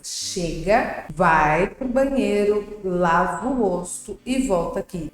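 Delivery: firm parent giving slow orders; controlled command voice, drawn-out key words; firm, clear commands. Shown as a demonstration. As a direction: spell out each order slowly and firmly. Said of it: "eu dou comandos claros pra criança".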